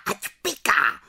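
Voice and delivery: high mocking witch squeal; shrill chorus of gloating witches. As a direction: jeer it like a pack of witches. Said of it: "vřískaly".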